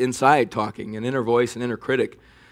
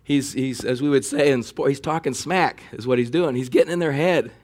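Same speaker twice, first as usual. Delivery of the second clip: ordinary talk, nothing marked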